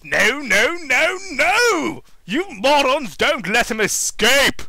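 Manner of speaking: loud, high furious announcement; villain tantrum. Acted. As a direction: rage at the useless robots. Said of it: "Robotnik announced over the speakers".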